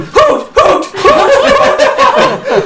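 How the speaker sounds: high-pitched hooting cries; game master's high hoot sound effect; imitated hooting. Game master imitates hoots heard.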